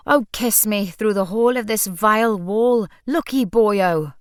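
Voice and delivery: loud theatrical Welsh declaiming; mock Welsh accent. A narrator's reading. Liam quoting the play as Dafydd, in mock Welsh accent. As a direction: play it big and Welsh, stretching the ending. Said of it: "as though he were Dafydd wearing his donkey head".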